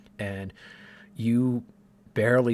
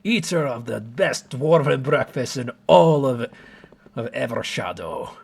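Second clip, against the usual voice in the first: proclaiming dwarf; 5 semitones higher, 9 dB louder than usual. Acted.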